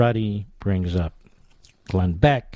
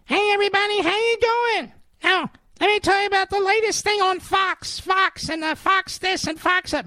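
much higher squeaky mock voice, like Minnie Mouse; Brian Stelter impression. Radio host mocking a rival anchor's voice, high squeaky falsetto. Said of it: "with his prepubescent voice, he sounds like Minnie Mouse".

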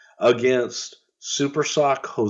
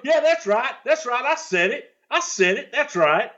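much higher, smug catchphrase; smug high-pitched gloat; heel catchphrase; 12 semitones higher than usual. Retold or imitated. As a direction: gloat over the insult. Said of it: "Buddy came up with".

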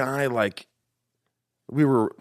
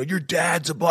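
higher-pitched imitated taunt; retold yell, pitched up; mock yelling; 9 semitones higher than usual. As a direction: jeer at a rival, half-acted. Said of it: "he starts yelling at me".

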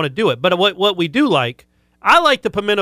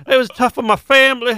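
a weak, higher put-on Southern accent; bad fake Southern accent; 8 semitones higher than usual. Cohost botches a Southern accent on a dare, fake Southern accent. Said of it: "You can't even do one".

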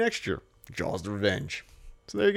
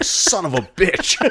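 slowed, louder, laughing mock shark curse; playful cartoon shark swearing through laughter; grumbling shark; 6 semitones higher, 7 dB louder than usual. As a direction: growl it out as the annoyed shark, cracking up. Voicing the shark's reaction.

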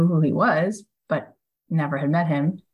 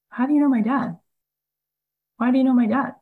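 surprised question; 8 semitones higher, faster than usual. Retold or imitated. Bill Gates surprised at dinner.

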